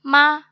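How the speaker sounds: fourth-tone मा, brief and emphatic; falling fourth tone. Shown as a demonstration. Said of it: "falling tone".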